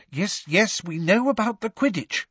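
high, tense brush-off; strained impatience. A narrator's reading. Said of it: "said Hermione in a tense voice".

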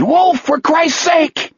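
high, drawn-out exasperated scream; desperate scream. A narrator's reading. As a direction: scream as if pleading with him to move. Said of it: "Jack screamed".

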